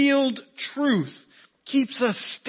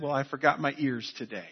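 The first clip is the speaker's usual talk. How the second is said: put-on low, soft, matter-of-fact child's answer; unfazed quick reply; 6 semitones lower, 7 dB quieter than usual. Young son answering his preschool teacher, unfazed and quiet. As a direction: toss it off quietly, imitating an unbothered kid. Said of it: "without changing demeanor or anything and just quickly said".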